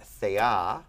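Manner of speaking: thea drawn out slowly, stress on the final A; final-syllable stress. Shown as a demonstration. Teacher showing the persistent accent, end-stressed thea. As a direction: lean on the last syllable of thea. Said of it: "where the accent wants to persist".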